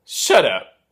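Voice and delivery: loud, punchy mock "shut up"; loud camp exclamation. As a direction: fire it off as a showy catchphrase. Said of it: "just [name] sat there every now and then just going".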